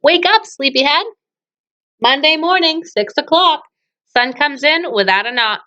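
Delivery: usual speaking voice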